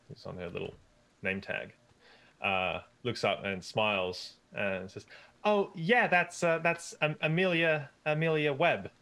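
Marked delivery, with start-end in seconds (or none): 5.4-8.9 high bright eager waitress voice, way up; chirpy waitress. Acted. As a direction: perk up as the waitress.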